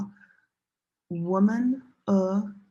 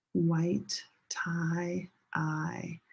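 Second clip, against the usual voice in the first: whisper; 2 semitones lower, 6 dB quieter, much slower than usual. Shown as a demonstration. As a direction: drop to a whisper so they speak. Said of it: "second time say it together with me".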